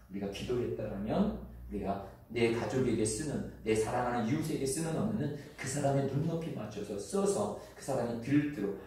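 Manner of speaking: ordinary talk, nothing marked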